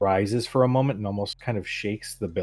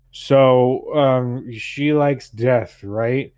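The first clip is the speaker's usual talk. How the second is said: put-on loud, higher-pitched drunken ranting; drunken man; 4 semitones higher, 9 dB louder, much slower than usual. Drunk man rants at the priestess about her goddess, loud and belligerent. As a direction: challenge them like a belligerent drunk, stretching the words. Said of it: "He's got a bottle of alcohol".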